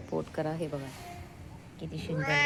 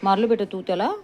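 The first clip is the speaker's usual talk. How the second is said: loud, higher coaxing question; 5 semitones higher, 8 dB louder than usual. Real, not acted. Owner loudly asking her dog if he hit back.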